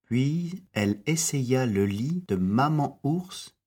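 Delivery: ordinary delivery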